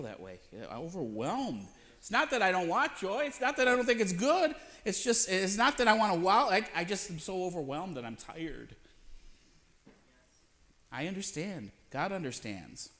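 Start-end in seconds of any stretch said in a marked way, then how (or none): none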